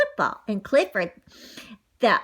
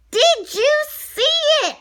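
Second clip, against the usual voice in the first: high, loud, drawn-out yell; excited yell; 6 dB louder, slower than usual. A narrator's reading. Boy who saw the monster score, excited yell. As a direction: yell it across the field. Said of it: "Riley yells".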